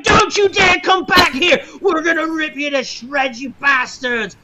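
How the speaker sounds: high, full-voiced furious crowd yell; angry yelling. Game master voicing dock crowd at fleeing ship, angry yelling. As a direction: yell after them in outrage, easing off at the end. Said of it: "you're just hearing the people yelling".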